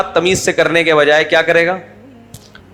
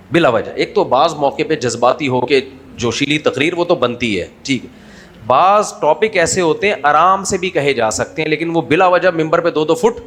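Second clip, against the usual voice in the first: normal talking voice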